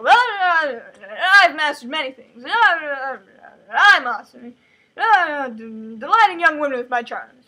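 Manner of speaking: a hint of a smug charmer; boastful laugh. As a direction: brag through a chuckle. Boy boasting about himself.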